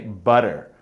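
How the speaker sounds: slow "butter" with a D-like t and a strong r; American butter. Shown as a demonstration. Teacher showing her own American "butter". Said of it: "As an American, I say".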